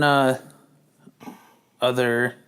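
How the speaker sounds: normal talking voice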